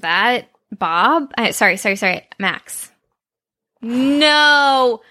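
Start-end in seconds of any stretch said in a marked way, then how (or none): none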